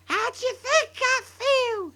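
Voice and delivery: put-on high, loud furious old-woman yell; yelled grandma. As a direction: yell it in a shrill fury. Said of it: "she yelled".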